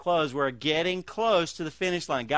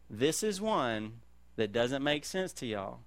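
hushed earnest advice; 7 semitones lower, 9 dB quieter than usual. Retold or imitated. Old believers passing wisdom to the young, hushed and sincere. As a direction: lean in and say it gently, like a confession.

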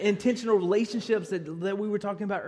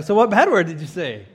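loud eager question; 11 dB louder than usual. Retold or imitated. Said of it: "I was like".

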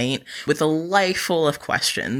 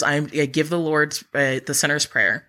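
normal talking voice

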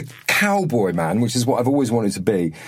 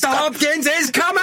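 excited shout. Retold or imitated. Announcing to crew excitedly.

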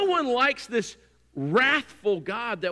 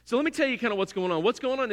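ordinary delivery

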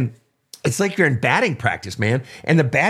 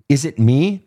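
ordinary talk

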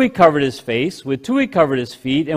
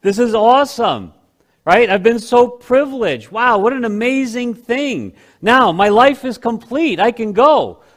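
giddy thrilled reaction; 6 semitones higher than usual. Pretend. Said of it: "we're gonna see a very different reaction".

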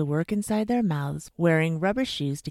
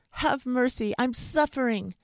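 high, pained pleading; wailing; 8 semitones higher, 4 dB louder than usual. A narrator's reading. Suffering fox to dentist, high wail. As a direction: beg for mercy in a wail. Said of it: "the fox wailed".

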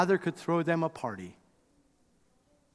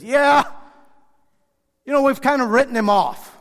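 loud, higher, dismissive dad voice; dismissive brush-off; 8 semitones higher, 9 dB louder than usual. Acted. Father dismissing the lost son, loud brush-off. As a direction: shrug him off loudly, half shouting. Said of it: "he says".

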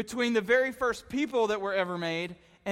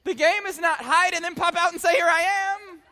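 mock scolding; 9 semitones higher, 8 dB louder than usual. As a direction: talk yourself out of it, half-laughing. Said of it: "you gotta be like".

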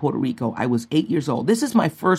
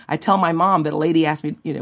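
ordinary delivery

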